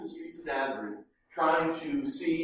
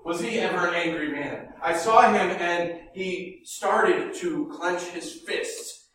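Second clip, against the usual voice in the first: ordinary talk, nothing marked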